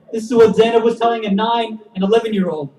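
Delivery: ordinary talk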